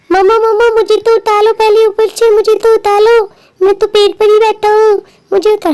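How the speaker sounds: put-on high-pitched small child's voice; pleading little child. Child stuck up a tree begs mama to be lifted down, high pleading child voice.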